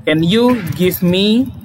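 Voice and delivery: slow, careful request: Can you give me some ideas?; teacher modelling a request, slowly; slow model sentence. Shown as a demonstration. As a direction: ask it slowly and clearly. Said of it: "Atau meminta gitu".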